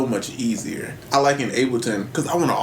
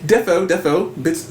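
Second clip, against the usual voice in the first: British accent attempt; 5 semitones higher than usual. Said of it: "It's something about the accent".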